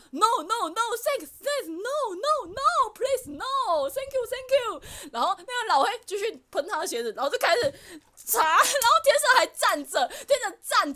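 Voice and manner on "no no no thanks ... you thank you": higher, flustered "no, thank you" repeated; a mock-frightened tourist backing away; imitating panicked refusal. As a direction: friend politely panicking at the shoe shiner. Friend refusing shoe shine.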